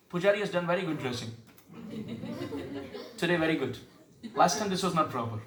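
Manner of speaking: lightly voiced self-satisfied critic with a laugh; a hint of a know-it-all temple-goer; mocking a smug devotee. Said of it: "But we go there".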